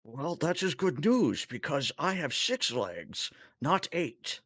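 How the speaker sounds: high-pitched, lilting Tholian voice; chittering alien. Acted. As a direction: speak as a fussy, high-voiced alien crewmate. Zyvor answers the doctor.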